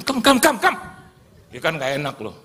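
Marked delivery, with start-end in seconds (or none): 0.0-0.8 high, loud repeated call; insistent summons. Retold or imitated. Preacher ordering him to come forward, loud insistent summons. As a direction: imitate an impatient summons, pitched way up.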